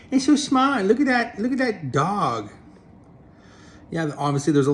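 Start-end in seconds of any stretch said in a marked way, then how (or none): 0.1-0.8 "so" pitched much higher with a falling tune; high mock awe. The cat mocks the dog in a high squeaky voice. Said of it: "dumb creature going". 0.8-2.5 high-pitched mocking cat voice, slowing on the last words; cat mocking a dog. The cat at the window mocks the dog, high and sneering. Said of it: "dumb creature going".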